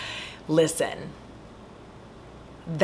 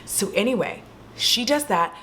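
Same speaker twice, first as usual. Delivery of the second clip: usual speaking voice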